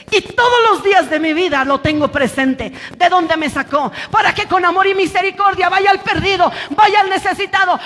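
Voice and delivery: full-force preaching, urging the congregation on; prédica enérgica. Real, not acted. (predicación enérgica y exhortativa)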